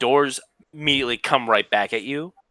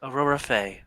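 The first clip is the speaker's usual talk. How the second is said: put-on young woman voice; 3 semitones higher, 4 dB quieter than usual. GM voices Aurora NPC.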